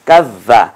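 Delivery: কাজ্জা with a held, doubled z; tashdid on the z. Shown as a demonstration. Teacher confirming the tashdid for learners, doubled z. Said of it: "জালে তাসদিদ আছে".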